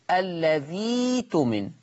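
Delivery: الذي تمن with a long "ii" and no glottal stop; very slow الذي تمن, no break before the t; hamza as long ya. Shown as a demonstration. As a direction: stretch the end of الذي, then تمن: "alladhiii-tumin". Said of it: "الياء، فتقرأ".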